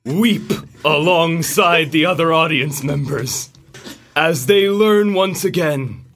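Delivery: dramatic movie trailer narrator. Acted. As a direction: epic trailer read, fighting back laughter. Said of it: "do their best trailer voice".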